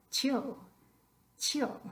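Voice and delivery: "Tío" squashed into one syllable, like "tyo". Shown as a demonstration. Teacher shows students how silly one syllable sounds, "tío" squashed. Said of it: "how would you say that in one syllable".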